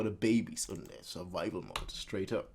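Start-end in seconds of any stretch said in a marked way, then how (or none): none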